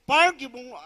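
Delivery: ordinary delivery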